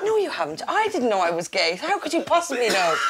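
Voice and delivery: high-pitched, disbelieving comeback; hammed-up outrage at being told; indignant retort. Retelling a coming-out row, mock indignant.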